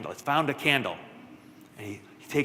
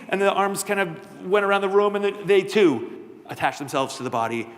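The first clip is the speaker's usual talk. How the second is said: plain reading voice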